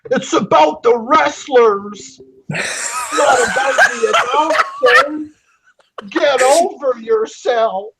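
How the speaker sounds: high, whiny mock voice, crying at the end; mocking tweet voice. Reading a wrestling exec's tweet in a whiny mock cry. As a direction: sneer it out like a weepy fanboy. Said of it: "I read a tweet from Jeremy Borash".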